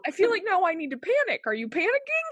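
high-pitched, hammed-up alarm; mock panic. Patient turning on the worried nurse, high mock panic. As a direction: let the pitch climb in comic panic.

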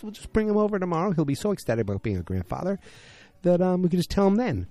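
high-pitched imitation of a wife; Trudy. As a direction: put on a high, chatty wife voice. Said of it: "Trudy's like".

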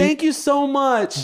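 high, drawn-out polite thanks; fake proper voice. Shown as a demonstration. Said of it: "trying to be proper".

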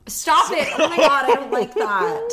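loud laughing protest; laughing shout. Real, not acted. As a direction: yell stop through laughter. Friend makes wet mouth noises.

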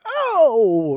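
high, let-loose sung "oh"; demonstrated bold "oh". Shown as a demonstration. Showing how to do an O, bold high cry. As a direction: open it up and let it bleed out. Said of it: "if you're gonna do an O".

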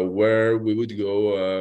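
ordinary delivery

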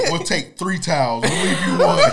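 laughing through a mock cop line; cracking up mid quote.

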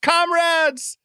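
put-on high-pitched kid's cheer; imitated yelling. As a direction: cry it out like an excited son. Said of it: "the stairs yelling".

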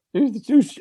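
very high, stumbling mock bafflement; baffled. Coach on the phone baffled by the name, imitated high and puzzled. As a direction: stammer it high in imitated confusion.

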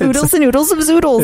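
high, quick cartoon exclamation. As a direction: sing it out like a kids' show.

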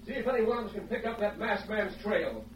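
distant voices across camp; far off. Acted. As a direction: heard from a distance. Posse heard from hiding place.

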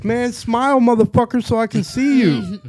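calling down to a friend, half-joking; retold calling out. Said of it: "and I'm like".

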